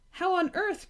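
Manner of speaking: high, startled cry of alarm. A narrator's reading. Moomin seeing the dragon on the curtain, alarmed cry. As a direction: gasp it out in alarm. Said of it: "cried Moomin, in great alarm".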